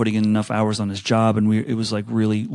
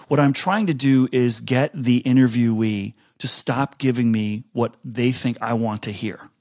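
ordinary talk

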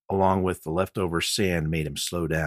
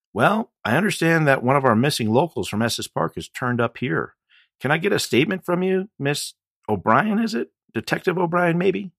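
much higher, playful needling; teasing probe; 11 semitones higher, 6 dB louder than usual. A narrator's reading. Chance teasing the detective about her rank, playful. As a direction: toy with her title.

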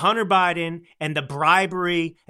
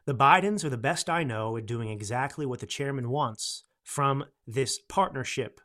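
quiet reread; 4 semitones lower, 6 dB quieter than usual. Shown as a demonstration. Re-reading quote quietly.

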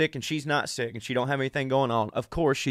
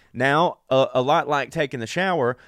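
usual speaking voice

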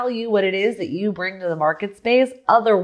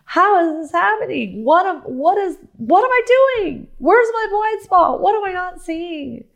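much higher, bewildered, panicky questions; retold panic; 12 semitones higher than usual. Coach reliving confusion at others' success, panicked disbelief. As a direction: spiral into confused alarm. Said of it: "And I'd be like".

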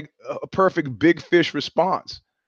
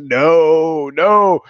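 ghostly voice; 2 semitones higher, 6 dB louder than usual. Retold or imitated. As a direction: moan it like a ghost in a painting. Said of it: "the ghostly voices".

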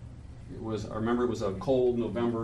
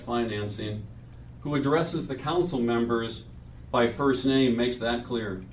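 measured poetry reading at a memorial; solemn reading. Acted. Reads his friend's poem aloud.

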